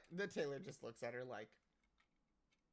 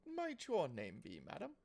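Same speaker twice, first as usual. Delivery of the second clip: high, courteous shopkeeper asking a name; raised-pitch formal tailor; lightly voiced fussy tailor; polite tailor voice; 10 semitones higher, 5 dB louder than usual.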